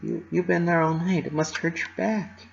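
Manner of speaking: gently pleading, barely audible; timid insistence; insistent whisper. A narrator's reading. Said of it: "she whispered".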